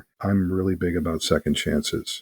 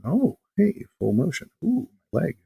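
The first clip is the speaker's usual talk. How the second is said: much higher, wondering ogre; delighted ogre; 12 semitones higher than usual. Retold or imitated. Healed ogre discovering he can move his leg, high delighted squeal.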